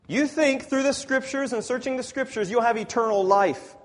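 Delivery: high, loud sermon challenge; forceful preaching. Acted. Preacher voicing Jesus to scripture searchers, forceful preaching. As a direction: press the point home, high and loud.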